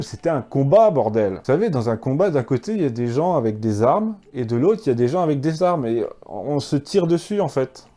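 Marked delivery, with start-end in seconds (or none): none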